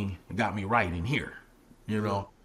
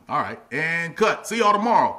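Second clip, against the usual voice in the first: loud, raised wrap-up call; director calling cut; 6 semitones higher, 8 dB louder than usual. Retold or imitated. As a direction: call the end of the shoot to everyone. Acts out director ending the shoot.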